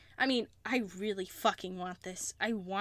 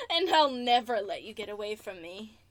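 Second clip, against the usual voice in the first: ordinary talk, nothing marked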